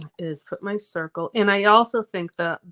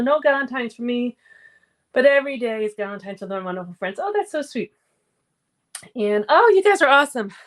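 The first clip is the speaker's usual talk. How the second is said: usual speaking voice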